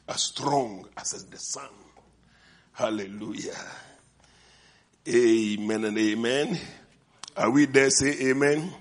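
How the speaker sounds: ordinary talk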